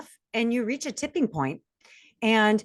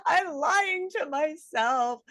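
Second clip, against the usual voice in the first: slow, high, crying delivery; retold breakdown, high and tearful; 9 semitones higher, slower than usual. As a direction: sob the words out. I can't do this anymore.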